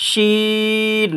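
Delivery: long, intoned "শিন"; three-beat elongation. Shown as a demonstration. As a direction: stretch "শিন" as sheeeen with a spreading sh. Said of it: "তিন আলিফ টেনে পড়বেন".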